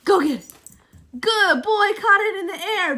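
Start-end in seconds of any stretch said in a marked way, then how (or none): 1.2-3.0 high, loud delighted praise; excited praise. Real, not acted. Owner cheering the dog's catch, high and loud.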